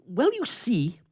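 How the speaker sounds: a touch of nerves as he resumes; nervous. A narrator's reading. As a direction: blurt it out uneasily. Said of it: "he resumed nervously".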